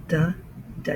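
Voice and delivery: "Duh, duh" as short, separate syllables; "Duh" repeated with a distinct D; D sound isolated. Shown as a demonstration. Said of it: "You can hear the D sound".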